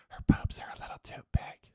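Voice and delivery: whispered, hushed and secretive; mock whisper. Mocking picky guys, whispering the complaint. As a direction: breathe it like a petty secret. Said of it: "It's not".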